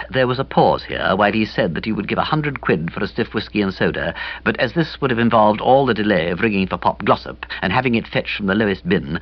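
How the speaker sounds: plain reading voice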